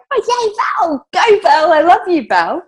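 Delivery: high, loud hammed-up cheer; mock cheering. Cheering Val on after Paul's praise, hammed-up and high. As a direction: gush like a fan at the finish line.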